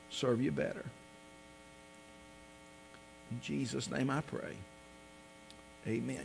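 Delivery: soft, hushed pastoral prayer. Acted. Leads the congregation in prayer.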